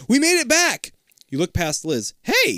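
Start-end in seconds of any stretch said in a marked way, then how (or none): 0.1-0.9 high, loud delighted shout; gleeful exclamation. A narrator's reading. Liz rejoices at getting out of the chute, gleeful shout. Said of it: "she exclaims gleefully". 2.2-2.5 very high, loud excited cry. A narrator's reading. You spot the museum past Liz, excited cry. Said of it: "you cry".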